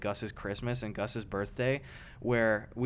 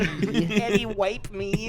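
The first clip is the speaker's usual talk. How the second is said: put-on baby voice; 9 semitones higher, 6 dB louder, much slower than usual. Mocking friend as baby.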